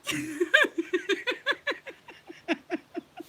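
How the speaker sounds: long loud high laugh; real helpless laughter. Real, not acted. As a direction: dissolve into laughter. Laughs at kid's punchline.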